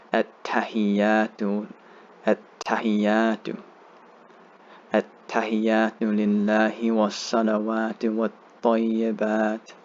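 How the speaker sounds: slow, even chant with long held vowels; slow recitation. Shown as a demonstration. Teacher reciting the tashahhud for learners, slow recitation. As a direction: recite slowly, leaving room to repeat. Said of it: "saying it as slow as you can".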